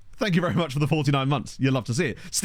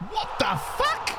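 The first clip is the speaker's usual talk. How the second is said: shocked disbelief; slower than usual. Real, not acted. Shocked by something on screen.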